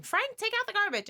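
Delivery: high-pitched mock nagging yell; imitated mom's shrill-pitched yell. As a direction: holler like a fed-up wife at her husband. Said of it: "my mom would yell at him".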